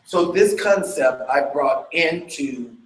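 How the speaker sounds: usual speaking voice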